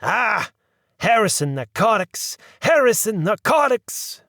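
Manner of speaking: high, loud mocking repetition; disgusted. A narrator's reading. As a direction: spit the name out in disgust. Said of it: "noise of disgust and annoyance".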